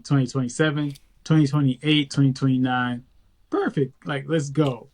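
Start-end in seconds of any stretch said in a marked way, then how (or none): none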